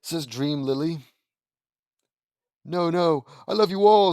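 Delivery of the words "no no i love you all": pleading shout. Acted. Lincoln pleading in nightmare.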